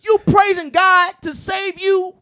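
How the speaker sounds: high, loud, shouting at the top of his voice; fired-up rant. Real, not acted. Ranting to friends about masked churchgoers, shouting furious. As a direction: preach the outrage full force.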